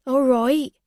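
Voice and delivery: easy-going barman; Louis voice. A narrator's reading.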